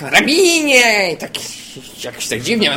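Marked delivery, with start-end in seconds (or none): 0.0-1.1 high, slow, drawn-out mocking call; a hammed-up drawn cry. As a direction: stretch it out high like a caricature. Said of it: "I tak mówią, tak".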